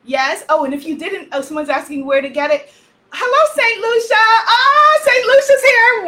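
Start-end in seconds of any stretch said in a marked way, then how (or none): 3.1-6.1 much higher and louder, a joyful cry of welcome; excited shout. Real, not acted. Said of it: "wondering why I'm so loud".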